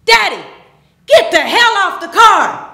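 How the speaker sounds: high, very loud yelled order; full-voiced retold shout of outrage; furious shout. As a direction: yell at your father to get off. Retold order yelled from car window.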